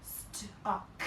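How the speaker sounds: Stuck whispered slowly and stretched; whispered retry. Shown as a demonstration. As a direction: whisper Stuck again carefully. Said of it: "Let's try again".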